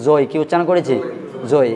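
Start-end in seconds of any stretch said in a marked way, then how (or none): 0.1-0.2 জয় as "zoi", gliding to an i; old faulty pronunciation. Shown as a demonstration. Teacher recalling the students' earlier reading, old faulty pronunciation. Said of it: "আমরা আগে উচ্চারণ করেছি". 1.5-1.7 জয় ending in an "oi" glide; old faulty pronunciation. Shown as a demonstration. Teacher forbidding this reading, old faulty pronunciation. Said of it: "এই উচ্চারণটা করা".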